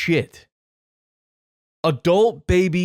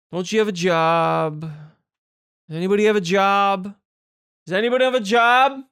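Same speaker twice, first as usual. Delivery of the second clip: exasperated; 2 semitones higher, 4 dB louder than usual. Real, not acted. Reactor demanding whether anybody has a job, escalating exasperation. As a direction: demand an answer, more exasperated each time.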